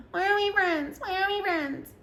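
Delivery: high-pitched mocking whine; put-on high, plaintive voice; whiny drunk friend. Imitates drunk friend whining at her.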